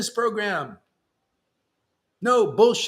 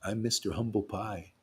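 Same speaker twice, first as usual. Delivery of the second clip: feigned humility; 8 semitones lower than usual. Acted. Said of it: "What do you want me to feign humility?".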